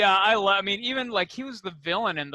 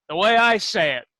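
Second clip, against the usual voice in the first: louder, higher retold retort; imitated raised voice; 4 semitones higher, 8 dB louder than usual. Quoting the actor's answer, raised voice. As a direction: raise your voice as if laying down the law. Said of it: "if he raises his voice at you".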